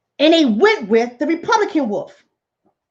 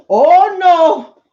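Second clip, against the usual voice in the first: loud, higher mock-fearful wail; hammed-up fright, drawn out and loud; scared sheep; 5 semitones higher, 6 dB louder, much slower than usual. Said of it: "poor sheep were scared".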